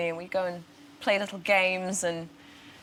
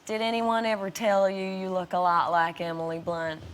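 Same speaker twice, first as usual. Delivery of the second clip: imitated Kansas farm-town accent; Midwest Southern accent. Actress mimics small-town restaurant local, Midwest Southern accent. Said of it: "sounds a little bit Midwest and Southern".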